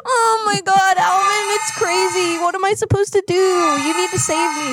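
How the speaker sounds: high, wailing mock panic; scared. Caller pleads with Alvin during abduction, hammed-up panic. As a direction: beg to be saved in a hammed-up frenzy. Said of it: "I'm scared".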